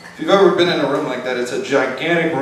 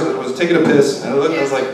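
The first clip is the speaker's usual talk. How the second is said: normal talking voice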